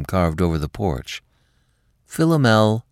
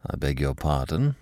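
formal; 5 semitones lower, 6 dB quieter than usual. Acted. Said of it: "he said formally".

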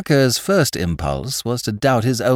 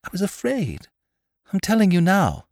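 high-pitched, distressed admission; fearful confession in a raised pitch; anxious pleading; 10 semitones higher than usual. A narrator's reading. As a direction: plead to be believed. Girl pleads her fear to detective.

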